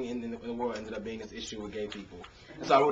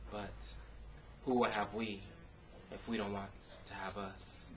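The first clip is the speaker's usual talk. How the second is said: rhythmic performed poetry with punchy builds; spoken word poem; 2 semitones higher, 9 dB louder, slower than usual. Acted. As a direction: perform it like a slam poem on an open mic stage.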